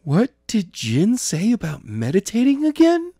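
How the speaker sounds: put-on high, puzzled young voice; Jenna wondering. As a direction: ask yourself, half-remembering.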